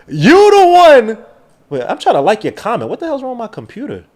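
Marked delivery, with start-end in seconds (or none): 0.1-1.2 a high, loud, slow shout of praise; hype shout. Real, not acted. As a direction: stretch it out and hype the donor up. Hyping up a donor.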